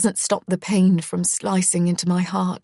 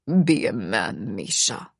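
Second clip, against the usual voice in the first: much lower, slow, stern father voice; harsh father quoted; 9 semitones lower than usual. Retold or imitated. Retelling the father's order to his son, low and stern. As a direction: lay it down low and slow like a cruel dad. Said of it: "he told me that day".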